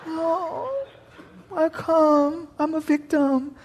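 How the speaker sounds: soft, high, feeble whimper; mock wimp. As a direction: play a helpless victim, hammed-up and faint. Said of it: "they'd be wimps".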